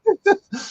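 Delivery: quick giggle; a short high laugh. Real, not acted. Laughs again at the banter.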